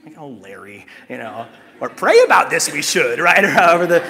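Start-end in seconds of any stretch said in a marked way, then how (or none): 0.2-3.2 inverted words in a croaky Yoda voice; Yoda impression. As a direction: do your best Yoda, playful. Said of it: "that's my best Yoda".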